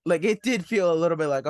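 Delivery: ordinary talk, nothing marked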